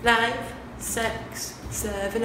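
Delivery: ordinary talk, nothing marked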